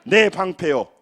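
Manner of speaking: loud phrase-by-phrase prompting with pauses; call-and-repeat. Shown as a demonstration. Pastor has congregation repeat God's promise, loud call-and-repeat. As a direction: call out each phrase for them to echo. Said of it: "따라합니다".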